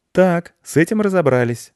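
put-on brisk, high-pitched little boy voice; pleased boy, pitched well up; cheerful boy. A narrator's reading. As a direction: chirp it up high like a perky boy. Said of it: "бодро сказал мальчик".

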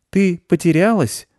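put-on high, slow boy's question; high-pitched young boy, taking his time; little boy. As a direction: puzzle it out in a high child voice.

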